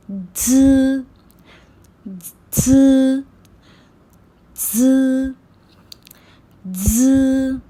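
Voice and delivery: ज said buzzed with teeth shut; pinyin z sound. Shown as a demonstration. Teacher modelling pinyin z as ज. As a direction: buzz ज through closed teeth. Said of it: "अब हम जेड को pronounce करेंगे".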